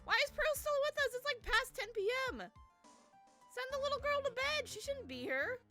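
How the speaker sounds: high-pitched squeaky complaint; high mock outrage.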